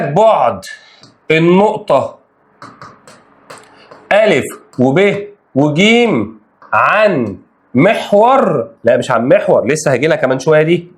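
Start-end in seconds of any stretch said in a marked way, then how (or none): 0.0-8.7 stretched word by word; slow emphatic reading. Shown as a demonstration. Teacher reading the problem to students, slow and stretched. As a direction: read the question out slowly so it sinks in. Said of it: "بص الجملة".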